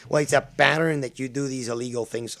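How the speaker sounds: loud, hammed-up Spanish-accented accusation; mock Spanish accent. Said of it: "doing the Spanish accent for Seaman".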